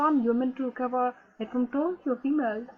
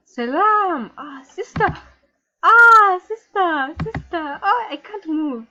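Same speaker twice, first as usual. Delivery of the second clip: loud wailing call; 4 semitones higher, 10 dB louder, slower than usual. Acted. Calling sister, crying out.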